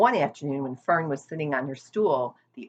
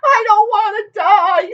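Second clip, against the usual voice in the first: much higher, loud shriek; desperate scream; 12 dB louder than usual. A narrator's reading. Wilbur screaming on the ground, much higher and louder. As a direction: throw yourself down screaming it. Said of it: "screamed Wilbur".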